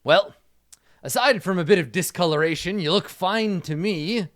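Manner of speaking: lightly voiced doctor giving a verdict; old doctor. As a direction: give a mildly hearty all-clear. Game master voices old doctor.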